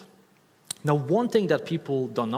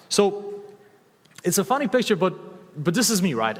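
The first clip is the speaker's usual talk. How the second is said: amused and raised; 5 semitones higher than usual. Real, not acted. Showing audience his teenage photo, higher amused voice. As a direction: push through the laughter, lifted up.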